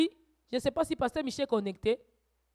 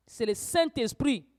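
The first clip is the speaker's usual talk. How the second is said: normal talking voice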